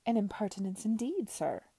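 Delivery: loud, mock-affronted villain; a hint of pompous host, pitch dropping at the end; oily gambling-house owner. A narrator's reading. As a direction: agree with showy indignation. Voices Beecroft, the gambling-house owner.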